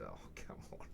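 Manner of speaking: very soft, low, whispered protest; exasperated. Retold or imitated. Buyer answering a secretary's privacy excuse, quietly exasperated.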